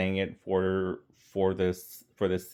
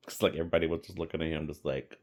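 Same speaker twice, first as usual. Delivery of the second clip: ordinary delivery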